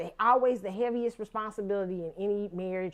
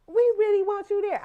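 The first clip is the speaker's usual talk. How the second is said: pleading cousin; 11 semitones higher, 6 dB louder than usual. Retold or imitated. Cousins pleading, inviting to wedding.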